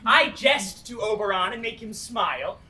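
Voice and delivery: ordinary talk, nothing marked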